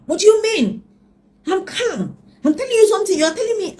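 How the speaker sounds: high, loud agitated protest; ex-husband yelling back, insisting he's calm; angry ex-husband. Retold or imitated. Ex-husband on the phone, refusing to calm down, high loud angry. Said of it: "when you are a lot calmer".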